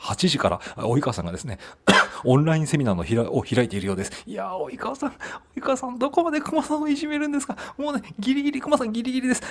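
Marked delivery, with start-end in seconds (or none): 4.3-9.4 high, thin put-on wail; mock plaintive plea. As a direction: plead as the bullied victim, hammed-up. Mock plea to a bullying rival.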